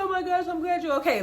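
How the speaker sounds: high, rushed imitation of relief; fast breathless relief; relieved mother. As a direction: gush it quickly, voice up high. Voices mom's relief.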